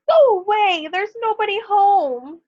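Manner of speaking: high, loud, terrified squeal; scared squeal. Acted. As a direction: shriek it at the door like a panicked piglet. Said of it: "The pig was so scared he squealed".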